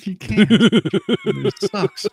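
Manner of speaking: laughing. Real, not acted. Laughing at alert advice.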